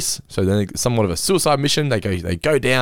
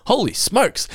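cheery exclamation; 12 semitones higher, slower than usual. Shown as a demonstration. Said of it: "genuinely surprised".

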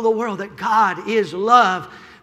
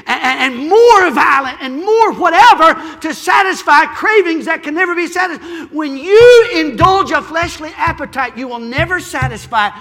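loud, high, climbing preacher's cry; preaching at full force; 7 semitones higher, 10 dB louder than usual. Acted. Preacher to his congregation, rising to a shout. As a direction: build the sermon to a shouting peak.

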